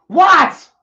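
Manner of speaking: high, loud outraged what; indignant yell. Real, not acted. Ranting at an imagined listener, indignant yell. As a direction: throw it out in disbelief.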